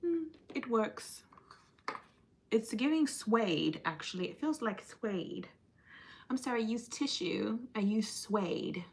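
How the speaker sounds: softly spoken mock-proper English accent; quiet, playfully proper English. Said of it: "That's a proper English accent".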